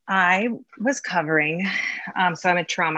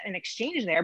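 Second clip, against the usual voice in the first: usual speaking voice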